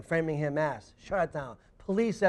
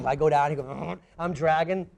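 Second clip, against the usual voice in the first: ordinary talk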